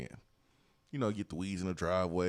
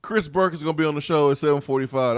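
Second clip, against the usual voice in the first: loud, raised-pitch proclamation; emphatic announcement; 4 semitones higher, 7 dB louder, faster than usual. Real, not acted. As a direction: belt out the answer like big news. Emphatic answer to own question.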